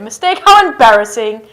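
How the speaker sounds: loud hammed-up mortification; mock embarrassment. Teacher admits a mistake, hammed-up embarrassment. Pretends shame at own mistake.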